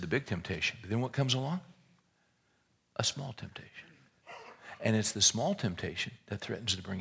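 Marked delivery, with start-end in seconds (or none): 3.0-3.9 dramatic hushed whisper; preacher whispering the key phrase for effect. Acted. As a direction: drop to a whisper to land the point. Sermon point dropped to whisper.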